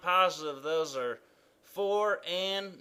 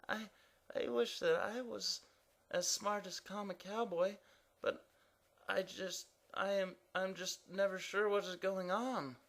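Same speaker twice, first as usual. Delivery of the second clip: soft, higher, hurried stammer; flustered Evan; 4 semitones higher, 9 dB quieter than usual. Acted. Evan answers the impatient king, flustered and unsure. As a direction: fumble for an answer.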